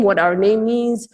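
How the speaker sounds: usual speaking voice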